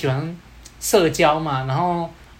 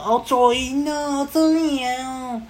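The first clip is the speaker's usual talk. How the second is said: high, long drawn-out Taiwanese Hokkien drawl; mock a wannabe punk's Taiwanese, stretching it high; Taiwanese-accented gangster; 8 semitones higher, slower than usual. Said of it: "他的台语".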